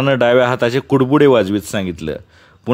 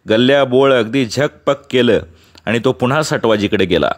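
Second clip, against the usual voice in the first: ordinary delivery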